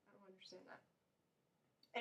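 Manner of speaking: slow, very soft, whispery aside; hushed aside. Real, not acted. Vlogger puzzled at who gets elected, hushed aside. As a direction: say it almost to yourself, slowly.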